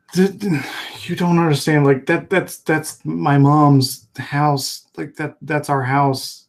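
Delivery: high, loud stammering plea; distressed protest. Acted. Character protests as home is firebombed.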